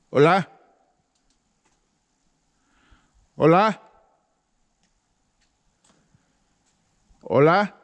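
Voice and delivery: llamado fuerte con eco. Real, not acted. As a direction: gritar hola para oír el eco. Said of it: "no sé si ustedes escuchan cómo se oye el eco". (hola resonando con eco)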